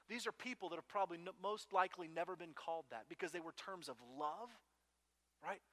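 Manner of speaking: normal talking voice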